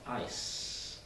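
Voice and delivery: "ice" whispered, soft s. Shown as a demonstration. Teacher shows students a whispered soft "ice". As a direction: hush "ice" out gently. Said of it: "It's very soft".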